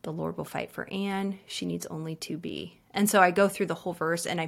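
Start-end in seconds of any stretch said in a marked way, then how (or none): 0.0-1.4 much softer, hushed breathing prayer; whispered-down prayer for Anne. Shown as a demonstration. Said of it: "I breathe out". 1.4-2.8 very soft, fading breathed prayer; softly praying the verse for a friend; hushed prayer breath. Shown as a demonstration. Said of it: "I breathe out".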